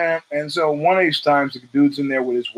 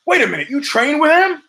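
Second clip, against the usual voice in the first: higher, imitating the husband's surprise; mock surprise; 12 semitones higher, 3 dB louder than usual. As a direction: play the husband catching on. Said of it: "the guy looks at him and goes".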